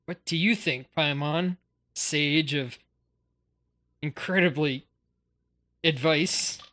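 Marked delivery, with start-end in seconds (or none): none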